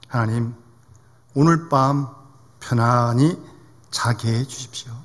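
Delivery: low, gentle, halting prayer; humble prayer. Retold or imitated. Grandmother asks God only for restful sleep, humbly.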